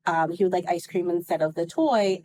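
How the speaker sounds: ordinary talk, nothing marked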